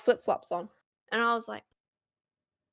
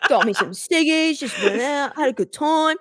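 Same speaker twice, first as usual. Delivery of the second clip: broad Australian; 3 semitones higher than usual. Acted. Rattling off slang to baffle him, broad Australian. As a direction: play up an Australian accent. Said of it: "throw in all the Australian slang words".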